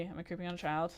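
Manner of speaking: normal talking voice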